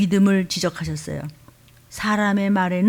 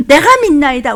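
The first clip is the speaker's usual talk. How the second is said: loud pleading cry of faith; 5 semitones higher, 8 dB louder than usual. Retold or imitated. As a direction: shout it out like the desperate father. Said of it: "아이아비가 소리를 지르면서".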